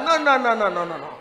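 chuckling denial, dropping away; laughing refusal. Acted. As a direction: shake it off with a laugh. Preacher rejecting worry, laughing.

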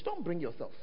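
normal talking voice

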